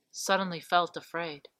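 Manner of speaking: plain reading voice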